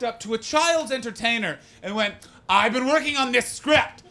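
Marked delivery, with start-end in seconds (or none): 2.5-4.0 loud, high, biting delivery; pushy, raised-pitch director caricature; George A. Romero's biting voice. Acted. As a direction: talk as if trying to bite the listener. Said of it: "like he's trying to bite you".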